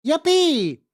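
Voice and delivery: high, loud little cheer; excited cheer. Real, not acted. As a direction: squeal it out with joy. Stream back after restart.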